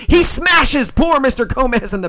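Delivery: hammed-up wrestling outrage; excited commentator. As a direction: sell the chair shot like a TV announcer. Wrestling play-by-play.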